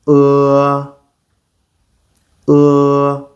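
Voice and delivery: "Uh" with mouth slightly open, tongue curled back; "Uh" tongue pulled back; Mandarin e vowel. Shown as a demonstration. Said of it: "Let's try it again".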